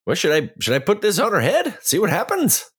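high, light character voice. Acted. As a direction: speak up high as your character. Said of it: "And I'm gonna say".

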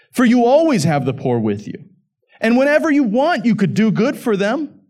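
high, loud pulpit delivery of Jesus' rebuke; emphatic Scripture reading. Acted. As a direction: proclaim it in defense of the woman.

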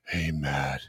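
very low, hushed creep; creepy voice. Acted. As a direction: drop it right down, creepy and soft. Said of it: "You wanna talk about creepy".

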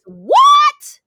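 high, loud shocked shout; a squealing what. Real, not acted. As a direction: blurt it out in disbelief. Surprised outburst at friend.

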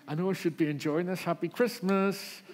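hammed-up sarcastic festive greeting; sarcastic parent. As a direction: fake Christmas cheer through frustration. Frazzled parent at Christmas.

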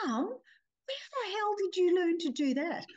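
imitating stunned grown children; amazed cry. Sons amazed at mum's goal, incredulous cry. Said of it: "they screamed".

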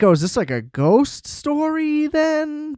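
puzzled. Retold or imitated. Viewer wondering about the plot.

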